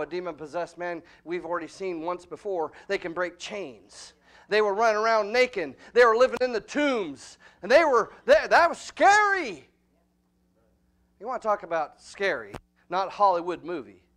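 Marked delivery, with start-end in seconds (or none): 4.5-9.7 rising, louder preacher's exhortation; full-force pulpit preaching. Acted. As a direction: preach it hard to the congregation. Preacher shouts about demons.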